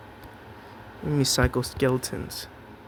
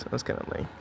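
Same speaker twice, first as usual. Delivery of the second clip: whispered aside; 10 dB quieter, faster than usual. Real, not acted. Unvoiced aside.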